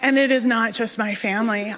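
usual speaking voice

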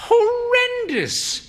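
high, slow, drawn-out dismay; Mother's voice. Acted. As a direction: stretch it high with long suffering. Old wife voiced in story.

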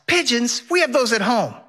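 put-on high, whining little boy voice; high whiny child complaining; whiny kid. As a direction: pout it out like a bored kid who's let down. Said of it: "Henry whines".

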